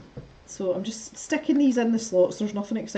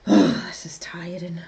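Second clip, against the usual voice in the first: tired whisper; 3 semitones lower than usual. Real, not acted. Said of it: "tired whisper".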